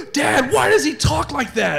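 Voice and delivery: put-on high-pitched, excited child's question; stoked kids. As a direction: blurt it like an amazed child. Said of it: "completely stoked".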